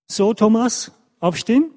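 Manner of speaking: lightly acted parent's morning call; mother waking her child. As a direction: voice a mum rousing her son. Mother wakes son in story.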